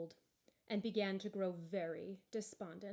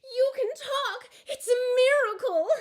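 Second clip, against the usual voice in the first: much higher, louder, slow astonished joy; amazed shout; 15 dB louder than usual. A narrator's reading. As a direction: shout in disbelief and delight. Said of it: "cried Jasper".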